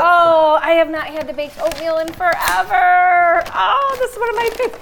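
loud, high, gushing delight; overjoyed at a favorite treat. Real, not acted.

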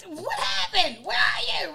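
high, loud panicked call; screaming for husband. Retold or imitated. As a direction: yell through the house for him. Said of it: "So I'm screaming like".

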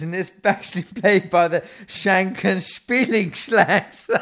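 laughing through the silly name. Real, not acted. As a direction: crack up while reading it out.

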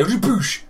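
loud high "Boosh!" punch noise; punch sound effect. Retold or imitated. Mimed left hand punching down a supermarket aisle, loud boosh.

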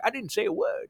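lightly voiced old-timer with a twang; a hint of a Western codger; old prospector twang. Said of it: "he'd be talking to me like".

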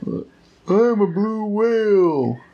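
high, slow whale-song voice; blue whale voice. Acted. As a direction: moan it out like a singing whale. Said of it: "How did I do?".

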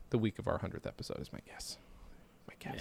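unvoiced aside; whisper. Real, not acted. Host guessing the release date, whisper.

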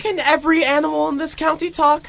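overwhelmed by yet another talking animal; incredulous outburst at the talking mole; amazed. Acted.

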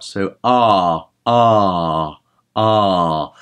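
wide open ah with flat tongue. Shown as a demonstration. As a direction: sing ah with lots of space. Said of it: "the better the sound".

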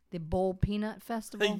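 Low Country accent, boiled said as "Bowl". Shown as a demonstration. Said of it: "I'm going to slip into my normal Low Country accent".

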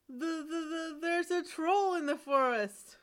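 a scared son tripping over his words; stammering fright. Acted. Son blurts to father about the troll, stammering in fright.

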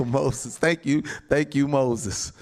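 ordinary talk

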